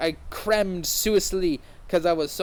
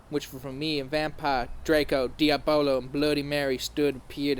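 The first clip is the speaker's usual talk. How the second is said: plain reading voice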